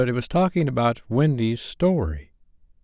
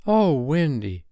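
higher exclamation, mildly excited; crying out; 6 semitones higher, slower than usual. A narrator's reading. Toodles interrupting Wendy, mildly crying out. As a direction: call out to Wendy. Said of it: "cried Toodles".

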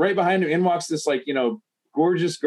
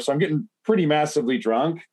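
usual speaking voice

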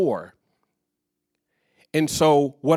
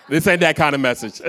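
laughing; 3 semitones higher, 4 dB louder than usual. Real, not acted. Laughs at audience misunderstanding.

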